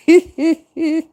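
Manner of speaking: high laugh. Real, not acted. Laughing at imagined conversation.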